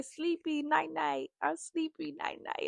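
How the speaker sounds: sing-song baby talk, lightly put on; imitating toddler daughter. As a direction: mimic a sleepy toddler saying night-night. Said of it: "When she sleepy, she say".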